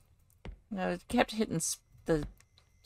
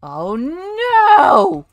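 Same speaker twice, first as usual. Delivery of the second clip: loud, high wail rising; alarmed cry; 12 semitones higher, 14 dB louder than usual. Real, not acted. Something triggered in game.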